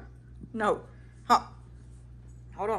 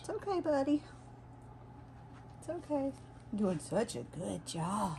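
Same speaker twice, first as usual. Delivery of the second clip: calm the nervous dog softly; soothing a scared dog; 3 semitones higher, 5 dB quieter than usual. Real, not acted.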